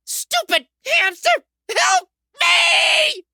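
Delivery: very high, desperate cry for help; screamed plea. Acted. Kid being bitten by a hamster, screaming for help. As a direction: wail it out in pain and panic.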